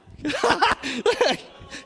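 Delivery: a burst of high, loud laughter. Real, not acted. Preacher laughing at his own joke, high laugh. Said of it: "Someone's getting a revelation".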